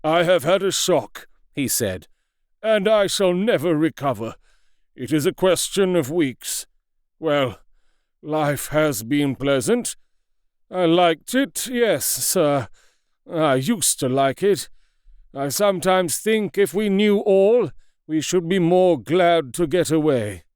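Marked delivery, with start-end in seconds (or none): 0.0-1.3 lightly voiced firm old doctor; a hint of a shaken, firm old man; firm doomed Lanyon. A narrator's reading. Lanyon declaring to Utterson he is doomed, firmly. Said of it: "with an air of great firmness that Lanyon declared". 2.6-20.4 a hint of a weary, doomed old man; lightly voiced doomed doctor. A narrator's reading. Lanyon telling his old friend he will die, resigned.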